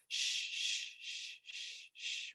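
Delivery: put-on soft, whispered shush as to a baby; soothing shushing. Shown as a demonstration. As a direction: hush them gently with voiceless shushes. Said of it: "when we're soothing someone".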